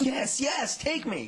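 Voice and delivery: high, loud, laughing eagerness; mock eager yes. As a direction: beg to be taken along. Eager reply to a TV offer.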